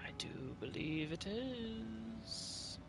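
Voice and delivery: soft, slow, breath-light awe; mock enchanted wonder. Player hearing a siren of the sea, spellbound hush. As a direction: drift slowly, as if lured by song.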